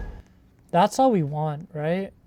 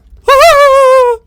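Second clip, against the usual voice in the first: sudden high loud laugh; a startled loud laugh at the screen; burst of laughter; 16 dB louder than usual. Real, not acted. Startled by the game, bursting out laughing.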